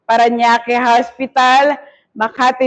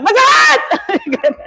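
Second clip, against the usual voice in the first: high, long wail of pain, half laughing; drawn-out mock scream; 11 semitones higher, much slower than usual. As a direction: stretch out the pained shout, hammed up. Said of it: "Hindi ganyan sa akin".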